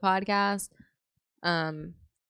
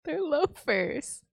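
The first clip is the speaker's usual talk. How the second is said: slow, high, teasing "They're loafers"; drawn-out punchline; 9 semitones higher, 4 dB quieter, much slower than usual. Real, not acted. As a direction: stretch out "They're loafers" playfully.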